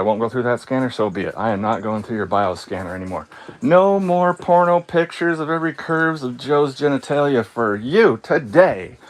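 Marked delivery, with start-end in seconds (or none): 3.6-9.0 raised, drawn-out final refusal; mock proclamation. Inmate refusing the jail's bioscanner, mock proclamation.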